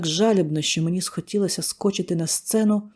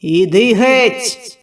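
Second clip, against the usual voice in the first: booming command; 5 semitones higher, 10 dB louder, much slower than usual. Acted. Exorcist commanding demon.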